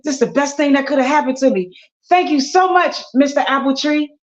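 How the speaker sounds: high, fast whoop of joy; excited. Acted. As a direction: burst out with joy. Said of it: "he excited".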